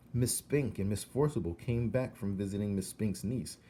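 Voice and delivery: ordinary delivery, nothing marked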